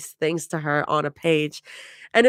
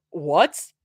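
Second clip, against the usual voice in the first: sputtering. A narrator's reading. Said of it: "Mira sputters".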